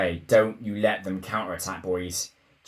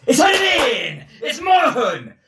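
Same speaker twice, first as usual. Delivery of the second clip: much higher, much louder, slowing on the goal; ecstatic goal call; 9 dB louder, slower than usual. Real, not acted. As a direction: roar out the goal like a commentator. Calling a goal as it happens.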